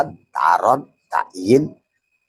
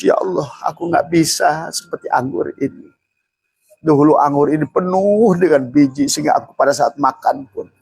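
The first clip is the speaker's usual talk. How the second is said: raised, pleading plea to God; tearful prayer; 7 semitones higher than usual. Retold or imitated. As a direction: plead as if near tears, higher. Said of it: "harusnya nangis".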